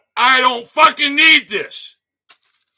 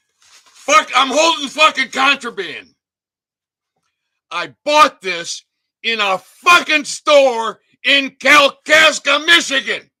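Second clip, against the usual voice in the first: loud, higher, slowed on the store's name; outraged shout; 4 semitones higher, 6 dB louder than usual. Real, not acted. Holding up the contraband for viewers: an outraged shout. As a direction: blow up at the label in disbelief.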